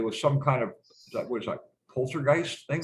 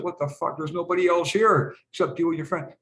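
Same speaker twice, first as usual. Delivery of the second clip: higher-pitched, exasperated dad; mock-incredulous scolding, pitched up; retold pissed-off retort; 6 semitones higher, 3 dB louder than usual. Dad confronting his son over spilled juice, retold annoyance. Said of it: "I was pissed off".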